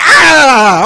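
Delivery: loud high fake crying yell; kid's fake cry shout. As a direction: bawl out like a bratty kid. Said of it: "He said".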